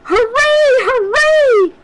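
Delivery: high, loud cheer. Acted. The toys cheering at the mountaintop, high and loud. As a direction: shout it for joy. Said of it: "cried the little".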